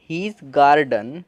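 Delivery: His garden drawn out very slowly; slow demo reading. Shown as a demonstration. Teacher reading His garden slowly to students. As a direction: garden read as gaar-dun, slowly stretched. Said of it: "आ पढ़ रहे हो इसीलिए गार".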